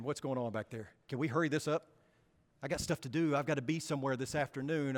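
impatient grumble. Retold or imitated. Impatient churchgoer tapping foot.

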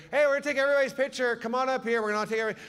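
high, loud, rapid rallying call; bustling lady organizer. Retold or imitated. Lady rounding up kids for a picture, high hurried call. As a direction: call everyone over at double speed, high and loud. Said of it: "Lady comes in, she goes".

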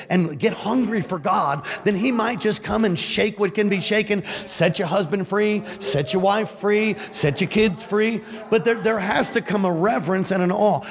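impassioned preacher's cadence; fervent preaching. Real, not acted. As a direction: drive each promise home to the congregation. Preaches at full force.

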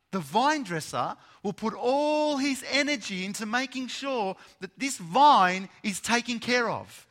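loud, high, driving sermon voice; preaching at full force. Acted. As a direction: proclaim it, building the fire.

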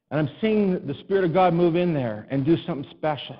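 normal talking voice

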